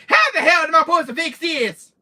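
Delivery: high, loud outraged complaint; hammed-up loud exasperation; indignant mock yell. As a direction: yell it like a put-upon victim. Acts out quarrel over broken table.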